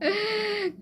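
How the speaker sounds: a quick high burst of laughter; short laugh. Real, not acted. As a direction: laugh at your own joke. Laughing at own joke. (ಚಿಕ್ಕ ನಗು)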